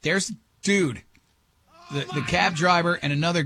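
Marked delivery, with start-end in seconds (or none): none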